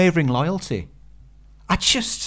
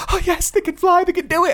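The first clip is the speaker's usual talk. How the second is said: much higher, thrilled cheer; excited cheering; 4 dB louder than usual. Retold or imitated. Cheering the flying monkeys over the wall, excited. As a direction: cheer them on like a fan. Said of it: "I was like".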